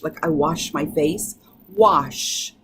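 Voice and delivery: usual speaking voice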